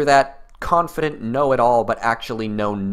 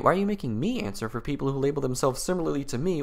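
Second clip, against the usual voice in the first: ordinary delivery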